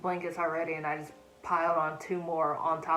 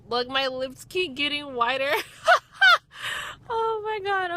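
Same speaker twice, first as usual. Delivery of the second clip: laughing; 9 semitones higher, 9 dB louder than usual. Real, not acted.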